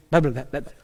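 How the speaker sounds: rapid-fire blah-blahs; mock your own sped-up talking; double-speed chatter. Shown as a demonstration. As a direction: run the blahs together like sped-up playback. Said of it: "I feel like I'm YouTube times two".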